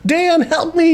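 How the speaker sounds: high-pitched hammed-up distress call; mock plea for help. Imitating Jeffrey calling Dan to help, mock plea.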